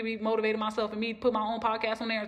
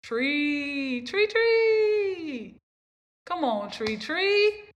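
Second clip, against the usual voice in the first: high, louder, calling out the name Tree; mom calling; 6 semitones higher, 4 dB louder, much slower than usual. Retold or imitated. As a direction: call her like a mother urging her on. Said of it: "my mom's voice telling me".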